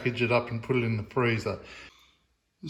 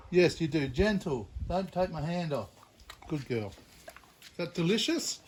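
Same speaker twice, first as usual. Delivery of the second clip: gentle with the dog; 2 semitones higher, 7 dB quieter than usual. Real, not acted.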